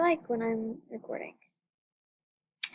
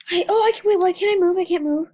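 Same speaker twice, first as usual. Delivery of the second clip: alarmed; 5 semitones higher, 5 dB louder, faster than usual. Real, not acted. Stuck in game, alarmed.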